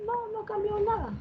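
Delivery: very high, soft and quick; quick, hushed, pitched far up; high soft answer. Shown as a demonstration. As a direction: answer your own question in a tiny high voice. Teacher answers own rhetorical question.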